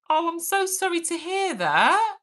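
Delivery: high-pitched, tender condolence; heartfelt sympathy. Real, not acted. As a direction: let sorry rise high with sympathy. Hears of viewer's parents' death.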